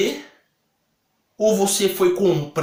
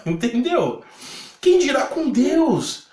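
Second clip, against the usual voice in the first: ordinary talk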